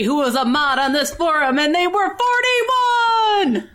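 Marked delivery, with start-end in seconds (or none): 1.6-3.7 shocked. Pretend. Role-playing a shocked forum user.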